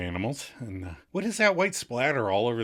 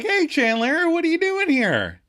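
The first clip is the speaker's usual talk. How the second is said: high, loud smiling greeting; 6 dB louder than usual. Retold or imitated. Smiling Kathy finds Chandler in the street, high and cheery. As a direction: imitate a cheery, high-pitched hello. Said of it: "And smiling Kathy's like".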